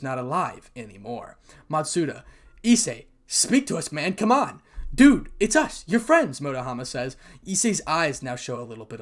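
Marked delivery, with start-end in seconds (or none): 2.6-4.6 raised, higher urgent pleading; urgent plea. Acted. Friends plead with unresponsive Issei. 4.9-6.4 high, loud insistent appeal; urgent plea. Acted. Friend pleads with unresponsive Issei.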